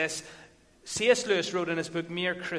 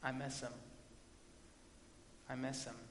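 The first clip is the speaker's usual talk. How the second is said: soft, breaking voice; voice cracking; 4 semitones lower, 15 dB quieter, faster than usual. A narrator's reading. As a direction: let the voice crack softly. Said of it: "Templeton's voice began to crack".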